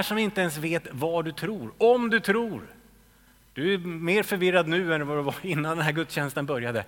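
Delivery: ordinary talk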